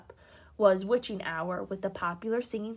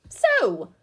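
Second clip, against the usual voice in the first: sharp, loud stern "So"; stern mother; 7 semitones higher, 13 dB louder than usual. Acted. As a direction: cut them off like an angry mum. Mother confronting guilty sons.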